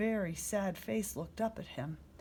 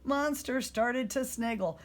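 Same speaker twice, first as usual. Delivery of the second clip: ordinary delivery, nothing marked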